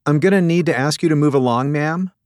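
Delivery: put-on high, brisk and courteous young docent; pert docent. Voices Kimberly, pert docent.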